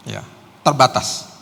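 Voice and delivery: normal talking voice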